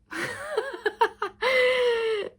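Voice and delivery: high-pitched giggling laugh. Real, not acted. Laughing at own secret-recipe joke, high giggle. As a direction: laugh in a long, high peal.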